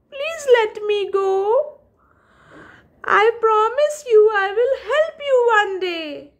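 high, pleading tiny mouse voice; squeaky little mouse. Acted. Little mouse begging the lion to let him go, squeaky high plea. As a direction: beg like a small frightened mouse.

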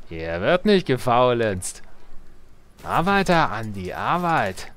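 slow, swooping game-voice commands; worker lines impression. Player quoting worker lines from the old game, slow put-on command. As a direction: draw out each order like a medieval game voice. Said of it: "würden diese Arbeiter-Sprüche auch passen".

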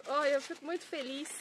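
ordinary talk

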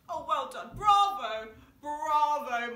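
loud, high posh congratulations; posh applause. Pretend. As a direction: gush like a very posh lady at the theatre. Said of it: "pretend you are really super posh".

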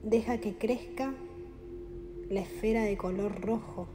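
guided meditation. Acted. Guide leading listeners through breathing and chakra visualization, calm meditation voice. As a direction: guide the listener gently into relaxation, unhurried.

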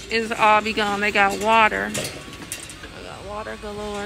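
ordinary talk, nothing marked

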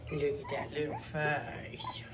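soft adoring whisper. Real, not acted. Admiring a pup's face, soft whisper. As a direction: breathe it over the pup. Whispering over newborn puppies.

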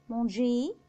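"مونجي" ending in é, r silent; French "مونجي", no r at the end. Shown as a demonstration. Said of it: "بعده حرف الآر بيتنطق إي".

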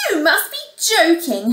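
high, loud scornful retort; incredulous, haughty stepmother. Acted. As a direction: dismiss the very idea.

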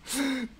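quick high real laugh; short delighted giggle; amused laugh. Real, not acted. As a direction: burst out laughing at the news. Laughs at unnamed puppies.

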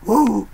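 high wrestler's "woo"; Charlotte. Retold or imitated. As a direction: attempt a high, drawn-out woo. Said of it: "I couldn't do the woo properly".

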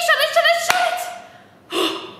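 quick, high pretend alarm; mock panic. Teacher pretending to slam the book shut on the snake. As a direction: slam it shut in playful fright.

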